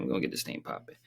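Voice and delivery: hushed whisper, no voice. Shown as a demonstration. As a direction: whisper it to yourself.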